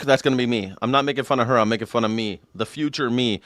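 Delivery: ordinary delivery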